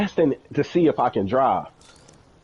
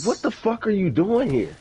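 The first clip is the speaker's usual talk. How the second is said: shocked; 7 semitones higher than usual. Retold or imitated. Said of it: "I'm like".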